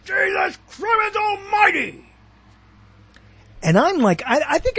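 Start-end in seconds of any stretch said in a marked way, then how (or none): 0.1-2.1 high, slow bellowed oath; loud. Retold or imitated. Father reacting to hearing Sears, loud shout. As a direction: roar it out like an outraged father. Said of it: "always loud".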